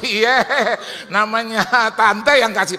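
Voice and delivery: high, full-voiced comic answer; hammed-up imitation of a pleased neighbour; loud acted reply. Acted driver's proud loud reply.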